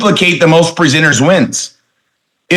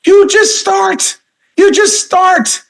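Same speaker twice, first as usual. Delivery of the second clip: much higher and slow, sung out; high exclaimed answer; much slower than usual. Acted. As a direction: stretch it out high and slow like a cheer. Answers the newbie's question, exclaimed.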